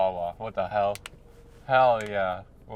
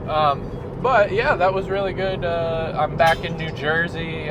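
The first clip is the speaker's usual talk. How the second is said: excited; 8 semitones higher, 5 dB louder than usual. Real, not acted. Raves about the wrap.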